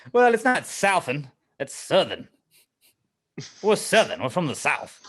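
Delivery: mock Southern accent, loud and lively. Podcaster mimics Southerners saying "Southern", hammed-up Southern accent. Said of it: "you pick up the Southern accent".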